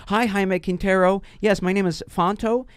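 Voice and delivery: lightly voiced, overly polite American customer; mock proper American voice on the phone. Caller faking an American voice for the scammer, overly polite. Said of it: "I'm speaking like this".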